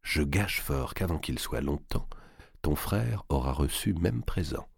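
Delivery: a soft confiding murmur. A narrator's reading. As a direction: murmur it close to his ear. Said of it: "elle murmura".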